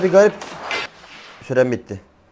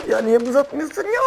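high laughing whine; 8 semitones higher, 5 dB quieter than usual. Real, not acted.

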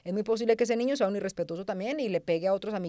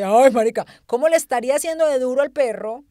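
1 mock dismay; 3 semitones higher, 8 dB louder than usual. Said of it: "La niña puso una cara de".

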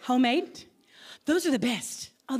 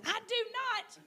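high, softer mock protest; imitated indignant denial; 11 semitones higher, 8 dB quieter than usual. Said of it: "she'd be going".

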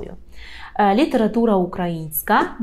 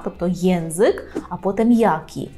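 ordinary talk, nothing marked

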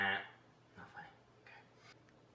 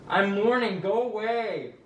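loud, high-pitched grieving wail; hammed-up crying; tearful mourning; 9 semitones higher, 8 dB louder, slower than usual. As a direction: wail at them to leave you alone. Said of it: "Don't have to cry about it".

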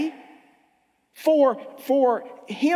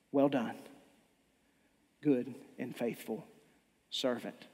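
Jesus welcoming; 11 semitones lower, 11 dB quieter than usual. Acted.

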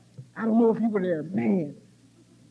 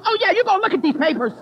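higher-pitched, mildly indignant insistence; indignant sponsee; 5 semitones higher, 4 dB louder than usual. Retold or imitated. Sponsee backs her up at the border, indignant. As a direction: chime in with mock indignation. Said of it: "I even had my little sponsee indignant".

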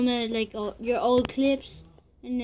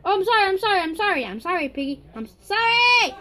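high, loud string of sorries; frantic apology; 7 semitones higher, 6 dB louder, faster than usual. Real, not acted.

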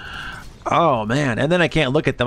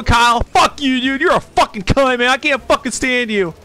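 hammed-up tantrum kid yelling at staff; angry kid insults; 9 semitones higher than usual. Troubled kid cussing out his youth worker, higher hammed-up yell. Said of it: "But, you know".

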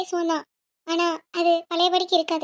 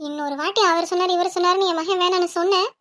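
normal talking voice

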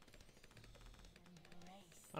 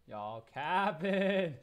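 slow disbelief; 6 semitones higher, 8 dB louder, much slower than usual. Real, not acted. Drawn out disbelief.

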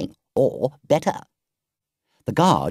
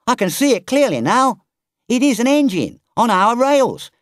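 higher, louder voice of a worried engine driver; puzzled engine driver; 10 semitones higher, 5 dB louder than usual. Acted. Engine driver to Toad, puzzled and uneasy, higher and louder. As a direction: let the driver's unease rise as he spots the pursuer.

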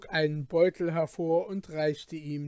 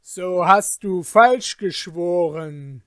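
loud, slow furious accusation; thundering; 2 semitones higher, 12 dB louder, slower than usual. Acted. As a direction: thunder it down at the merchant. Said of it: "donnerte der Kalif den Kaufmann an".

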